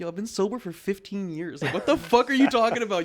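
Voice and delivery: high, exasperated mock outburst; acting out an incredulous comeback; indignant retort. Retold indignant reply to fans.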